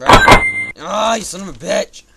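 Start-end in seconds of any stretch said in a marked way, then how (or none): 0.0-0.4 very high loud yell; high furious screech; high shriek of rage. Real, not acted. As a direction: screech in fury. Raging gamer.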